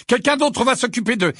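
high, loud, hurried shout; urgent cry. A narrator's reading. Harry urges Missus Wesley out, urgent shout. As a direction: shout it urgently until cut off. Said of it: "s'écria Harry".

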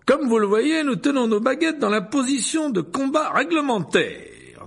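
high, loud, self-satisfied lecturer voice; pompous wizard boasting to the hall; vain showman professor. A narrator's reading. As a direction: preen before the students. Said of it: "dit Lockhart à la foule".

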